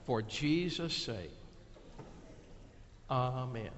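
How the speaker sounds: slow, hushed prayer with long pauses. Real, not acted. Closes with children's prayer.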